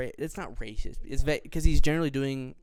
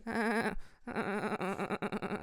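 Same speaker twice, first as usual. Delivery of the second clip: high, slow mock RFK voice; RFK impression; 7 semitones higher, 5 dB quieter, much slower than usual. Host still doing RFK, high and slow. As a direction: keep the high strained RFK voice going. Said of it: "I'm still R F K".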